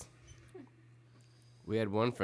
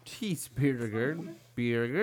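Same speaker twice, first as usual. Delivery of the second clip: drawn-out mock American accent; 4 semitones higher, slower than usual. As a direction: stretch it out in a hammed-up American accent. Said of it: "Can you do your best American accent?".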